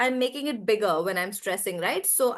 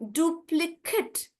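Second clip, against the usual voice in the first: "duplicate" slow, ending in short "kut"; stress on DU; 5 semitones higher, slower than usual. Shown as a demonstration. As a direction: break "duplicate" into "DU-pli-kut". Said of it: "I'm stressing on do".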